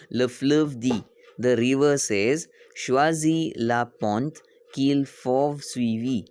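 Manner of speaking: slow, word-by-word teacher reading; slow read-aloud. Shown as a demonstration. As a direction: read it slowly so learners can follow. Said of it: "why I'm reading slow".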